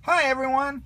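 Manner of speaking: high, happy call; Andy greeting his toys after camp; excited greeting. A narrator's reading. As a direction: burst in glad to be home. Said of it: "he cried".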